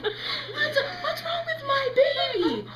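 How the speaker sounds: high, slow, loud mock wail; wailing mother. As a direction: play a hysterical mom, drawn out. Panicked mother voiced in a story.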